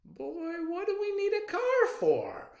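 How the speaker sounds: high, loud grandmotherly scolding; a playful grandma's rebuke; Nana's voice. A narrator's reading. Grandmother chiding her grandson, high and loud. Nana scolding CJ about a car.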